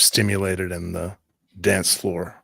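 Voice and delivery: ordinary delivery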